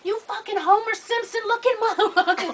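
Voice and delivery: high, laughing mock insult; a daughter imitating her drunk mother's yelling; mock drunk cussing. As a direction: spit it out through laughter. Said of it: "she going out".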